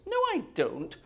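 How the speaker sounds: high-pitched, playfully affronted denial; mock indignant protest. Streamer retelling his reply to a German accent remark, mock indignant.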